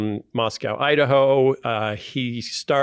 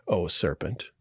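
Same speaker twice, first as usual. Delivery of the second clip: ordinary talk, nothing marked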